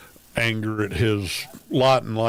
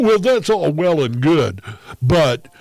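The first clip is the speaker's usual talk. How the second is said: condescending lecture; 5 semitones higher, 5 dB louder than usual. Retold or imitated. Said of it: "he has, like, four or five different pet issues".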